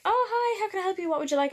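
high bright service voice; chirpy shop assistant greeting; cheery cashier voice. Retold or imitated.

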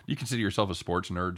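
ordinary delivery

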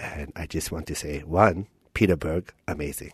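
very low, soft dad voice; father. Acted. Dad reviewing his son's movie, low and hushed. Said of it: "I'm gonna play your dad".

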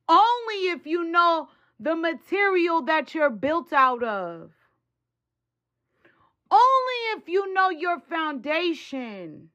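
fiery high-pitched sermon; preaching. Acted. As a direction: preach it with full force. Preaches at full force.

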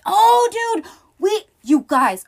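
sudden high, loud burst of excitement; a real squeal of excitement at the next scene; excited outburst. Real, not acted. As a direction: blurt it out, thrilled.

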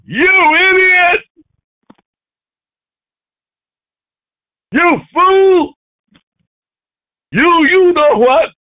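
very high, loud acted shouting; furious name-calling, acted at a yell; angry emphasis. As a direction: hurl the insults with full force. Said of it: "When you're angry".

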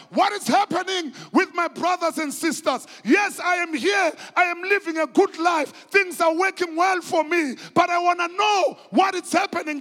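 shouted high and loud; Nehemiah's cry. Retold or imitated. Preacher voicing the prophet asking after his people, shouting. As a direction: proclaim it like a prophet demanding answers. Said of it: "Uthi Nehemiah".

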